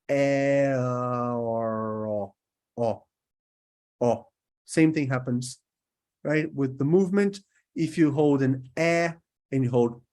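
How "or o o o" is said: a long, rounded "or" drawn out; long held o sound. Shown as a demonstration. Teacher holding the "or" vowel for students. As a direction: hold the "or" vowel, then repeat it. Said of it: "So we got".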